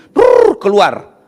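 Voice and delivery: slow rolling brrr like a toilet flushing; mock flush noise. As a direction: imitate the flush with lips. Bunyi siram kloset. (tiruan bunyi siram kloset)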